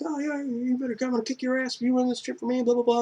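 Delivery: high, mocking voice of the angry kids; squeaky mock taunt, quick. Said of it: "they're all like".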